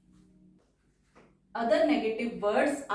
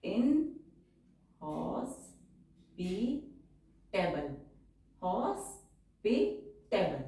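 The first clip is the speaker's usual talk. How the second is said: slow dictation; 4 dB quieter, much slower than usual. Shown as a demonstration. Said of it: "तो ये हो जाएगा इस तरह".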